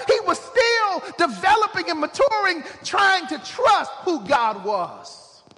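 high, driving sermon delivery; impassioned pulpit storytelling, pushed up high; full-force preaching. Real, not acted.

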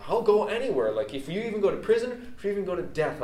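high, impassioned pledge of loyalty; acted eager vow. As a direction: imitate an over-eager follower promising everything.

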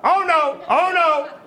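very high, loud mimicked bird squawk; squawking parrot. Parrot in its falling cage, squawking. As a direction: squawk it in imitation of a panicking parrot.

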